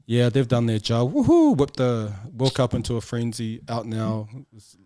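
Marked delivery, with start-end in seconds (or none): 1.1-1.6 high, loud mock whoop; mock cheer. Bosses cheering the hyped World Cup, mocked. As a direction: sneer a fake celebration.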